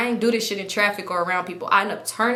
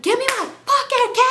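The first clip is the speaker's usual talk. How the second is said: very high, louder exasperated yell; mock screech, building on the last word; hammed-up yelling; 5 dB louder than usual. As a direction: act out screaming for the keys. Acted struggle over car keys.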